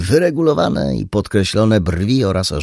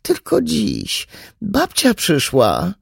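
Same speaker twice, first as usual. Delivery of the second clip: begging mother; slower than usual. Acted. Mother begging daughter downstairs.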